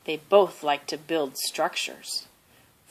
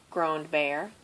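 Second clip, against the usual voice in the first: ordinary delivery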